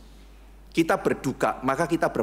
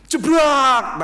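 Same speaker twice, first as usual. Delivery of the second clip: loud, higher crash sound jebrak; crash noise jebrak; 10 semitones higher, 7 dB louder than usual. Retold or imitated. Preacher telling a car crash story, loud crash noise jebrak. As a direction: bark out jebrak like a sudden collision.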